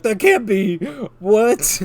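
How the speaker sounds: high, loud mock shock; hammed-up What? of disbelief. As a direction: act out a stunned detective. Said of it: "the dude's like".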